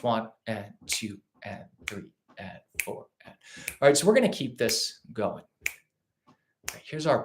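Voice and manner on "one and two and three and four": soft, even eighth note count; counting straight eighths. Shown as a demonstration. Teacher counting straight eighths for students, soft and even. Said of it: "we're thinking these straight eighth notes".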